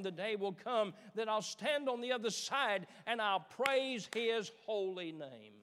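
loud, rising sermon climax; preaching at full force. Acted. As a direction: build the preaching up to a shout.

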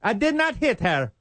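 high put-on voice of the player denying it; mock player denial. Cohost mocks the player's denial in a high put-on voice. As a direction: squeak out the denial like the accused player.

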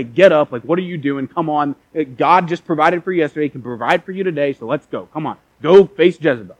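loud mock drill-like urging; impatient scolding. Angel ordering Elijah up to face Jezebel, impatient. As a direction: rouse someone roughly, bursts of volume.

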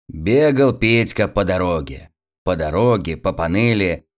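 usual speaking voice

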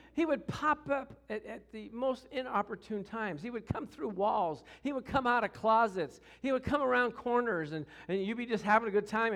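normal talking voice

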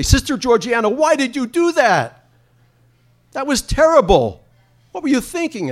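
high, loud, accusing cry at the nun; indignant outcry. Pretend. Boy confronting the nun, loud and high.

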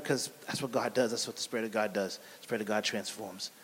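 low, soft aside of faith; hushed assurance. Real, not acted. Preacher assuring listeners of what God does, hushed assurance. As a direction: drop the voice low and quiet.

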